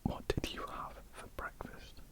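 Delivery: ordinary talk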